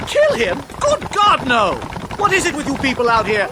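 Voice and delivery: high, loud, aghast exclamation; shocked denial. Acted. Professor aghast at being asked if he'll kill. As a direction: recoil at the very idea.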